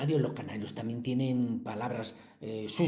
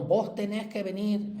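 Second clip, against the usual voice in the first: higher, Argentine-style "Vos tenés que venir"; Argentine voseo; 7 semitones higher than usual. Retold or imitated. Teacher imitating Argentine speech, light Argentine accent. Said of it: "tú no dicen nunca".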